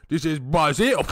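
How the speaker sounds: loud half-Spanish accent, hammed up; mock Spanish accent. Mocking Vin Diesel in a half-Spanish accent, loud. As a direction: shout it in a fake Spanish-English accent. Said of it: "that Spanish, half Spanish, half English".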